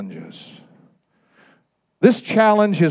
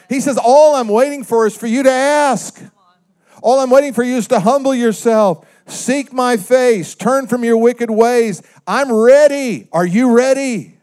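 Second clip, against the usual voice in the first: high, loud preaching that slows on the call; fiery preaching; 7 semitones higher, 4 dB louder than usual. Acted. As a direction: preach it as God's invitation, pressing. Preacher voicing God's call.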